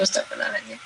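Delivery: repeating astagfirullahaladzim to herself in a fading low whisper; whispered istighfar. Shown as a demonstration. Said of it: "kemudian jalan".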